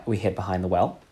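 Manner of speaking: ordinary delivery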